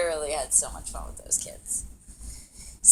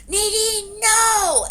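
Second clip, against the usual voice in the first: high, loud mock teacher yell; alarmed shout; 11 dB louder, much slower than usual. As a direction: shout a warning across the court.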